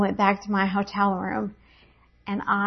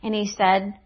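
usual speaking voice

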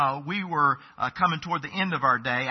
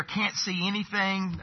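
normal talking voice

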